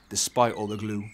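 ordinary delivery, nothing marked

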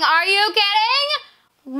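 high, slow drawn-out are you kidding; stunned disbelief. Real, not acted. Kid stunned by a gift, drawn-out disbelief. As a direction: stretch out the disbelief.

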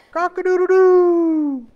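high, full-volume cock-a-doodle-doo; sudden piercing rooster imitation; loud rooster crow. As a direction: crow like a rooster, last note held long. Imitates the rooster interrupting Peter.

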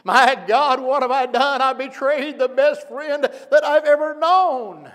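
high, loud anguished wailing; anguished cry. Acted. Peter crying out after betraying his best friend, anguished. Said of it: "you can imagine".

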